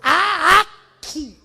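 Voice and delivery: "Há aqui" very slow and loud; drawn-out God's voice. Acted. Preacher proclaiming God's words slowly to the crowd. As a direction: stretch it out like a proclamation.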